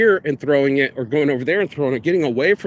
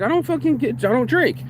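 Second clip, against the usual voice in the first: higher, exasperated; exasperated retort; 9 semitones higher than usual. Retold or imitated. Telling the bar staff he doesn't drink, exasperated. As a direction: push back hard, fed up. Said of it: "and I'm like".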